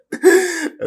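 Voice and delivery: a sudden loud high laugh; high burst of laughter. Real, not acted. Laughing at a girl's four-thousand price tag, a high burst.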